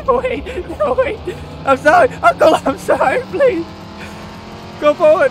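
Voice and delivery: high, loud frantic begging; panicked pleading. Pretend. Gamer to rolling car, shrieking in panic. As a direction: scream for mercy as the car goes backwards.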